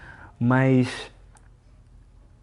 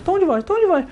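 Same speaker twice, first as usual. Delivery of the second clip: much higher, squeaky top of the range; high tone take. Shown as a demonstration. Host showing his cohost a high-pitched take of the phrase. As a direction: jump the pitch way up on tom de voz.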